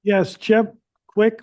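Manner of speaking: loud and high into the phone; to someone hard of hearing. Real, not acted. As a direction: raise your voice for a bad line. Answering a caller on a bad line.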